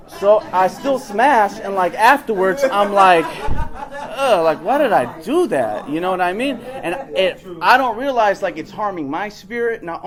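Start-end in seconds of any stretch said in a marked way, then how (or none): 3.4-5.9 high laughing groan of regret. Retold or imitated. Regretting the hookup afterwards, laughing groan. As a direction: groan at yourself, half laughing.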